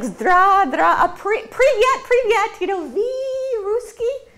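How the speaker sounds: mock bad Russian. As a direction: fumble a few words of broken Russian like a clueless tourist. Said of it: "and he's like".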